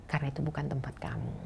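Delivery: softly voiced mother's solemn warning; a hint of mother's grave quiet advice; hushed warning. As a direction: hush down as if passing on a sacred rule.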